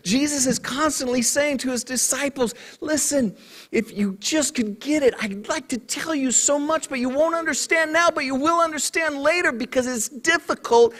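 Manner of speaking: high-pitched, sustained sermon delivery; impassioned preaching. Acted. Preacher to congregation on missing what God says, impassioned. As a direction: press the point home from the pulpit.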